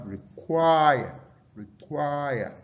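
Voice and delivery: "require" slowly with QUIRE drawn out; stress on second syllable. Shown as a demonstration. Teacher demonstrating verb stress, long QUIRE in "require". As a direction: punch and stretch QUIRE in "require". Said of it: "stress the second syllable".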